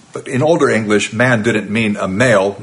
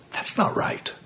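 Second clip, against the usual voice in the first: "that's not right" whispered, much softer; whispered aside; 11 dB quieter than usual. Real, not acted. Preacher repeats verdict, dropped low.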